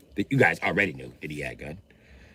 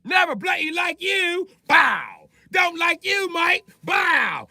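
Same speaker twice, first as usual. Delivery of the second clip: mock furious shout; much slower than usual. Boss yelling at security guard Mike, mock furious. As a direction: bellow it like an unhinged boss.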